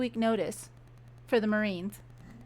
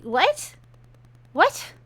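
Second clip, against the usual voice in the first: stunned what; 5 semitones higher than usual. Real, not acted. Disbelief at the book.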